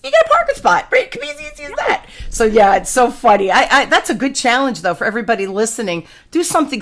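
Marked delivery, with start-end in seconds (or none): 0.0-2.1 much higher, quick playful squeak; light high-pitched tease; squeaky punchline. Real, not acted. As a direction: toss it off in a tiny high voice.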